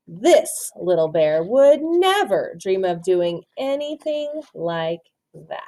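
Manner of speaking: usual speaking voice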